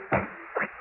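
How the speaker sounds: whispered, cold and close; menacing whisper. Acted. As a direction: breathe it into his ear. Killing his friend.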